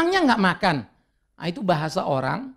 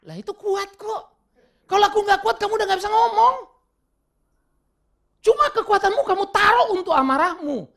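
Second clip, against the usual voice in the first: raised retort; 13 semitones higher, 4 dB louder than usual. Retold or imitated. Pastor retorting to counselee.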